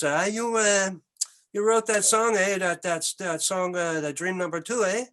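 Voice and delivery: put-on a tough Montreal biker in a Québécois accent. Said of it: "I'm gonna use an accent".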